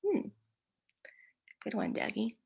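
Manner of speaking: ordinary talk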